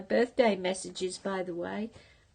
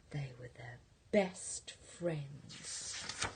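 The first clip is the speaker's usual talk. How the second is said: slow hushed whisper; 6 semitones lower, 8 dB quieter, much slower than usual. A narrator's reading. Ending the story for the boys, slow whisper. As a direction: breathe the ending softly.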